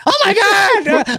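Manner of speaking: very high, slow, overdone wail; hammed-up panic. Shown as a demonstration. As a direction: ham up the terror. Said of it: "that would have been funny".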